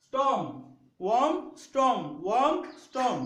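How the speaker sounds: ওয়ার্ম and স্টর্ম sounded out with matching endings; chant-like teacher's demo of ওয়ার্ম rhyming with স্টর্ম; rhyme demonstration. Shown as a demonstration. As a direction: punch ওয়ার্ম rising, then স্টর্ম falling, to show the rhyme. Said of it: "আমরা বলতে পারি ওয়ার্ম".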